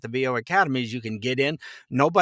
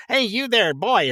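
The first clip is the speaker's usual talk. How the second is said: Scrooge calling; 9 semitones higher, 4 dB louder than usual. Retold or imitated. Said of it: "Scrooge is".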